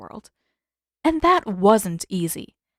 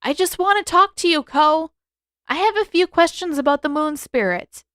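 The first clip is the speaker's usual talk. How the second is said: raised, high voice carrying through the cave; calling out; 8 semitones higher, 5 dB louder than usual. A narrator's reading. Aang calling to the hidden spirit Ko, raised voice. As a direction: call out to an unseen spirit.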